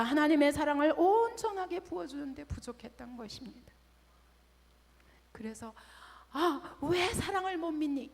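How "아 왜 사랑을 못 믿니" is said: slow, tearful, higher plea; mild sob of a hurt mother; tearful mother's plea. Retold or imitated. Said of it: "이렇게 말할 일이 아니었습니다". (울먹이며 묻는 엄마)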